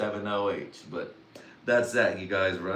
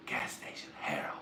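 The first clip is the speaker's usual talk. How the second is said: whispered, as if ashamed; whispered confession; 6 semitones lower, 9 dB quieter than usual. Pretend. Confessing where the money went, in a whisper. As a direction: hush it like a dirty secret.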